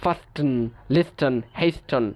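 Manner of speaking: ফাস্টেন, লিস্টেন, হেস্টন each with an audible t; T pronounced. Shown as a demonstration. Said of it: "উচ্চারণ করতে কষ্ট হচ্ছে".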